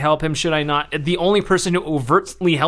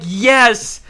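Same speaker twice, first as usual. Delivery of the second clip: excited cheering; 6 semitones higher than usual. Retold or imitated. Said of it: "And I'm like".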